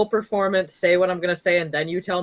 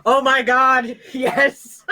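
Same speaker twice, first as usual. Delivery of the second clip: loud, higher-pitched thrilled outburst; real excitement; 6 semitones higher, 6 dB louder, much slower than usual. Real, not acted. Player sees a great dice roll, real excitement. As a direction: celebrate with a laugh breaking in.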